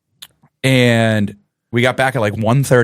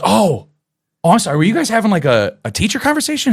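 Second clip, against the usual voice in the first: put-on higher, eager kid apologizing; apologetic student; 7 semitones higher, faster than usual. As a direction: pipe up politely, as if interrupting adults.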